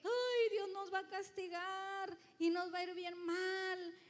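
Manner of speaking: a whiny, put-on cry of self-pity; mock lament. Preacher mocking a fearful lament to the church. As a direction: whimper it like a scared complainer. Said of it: "él no estaba llorando".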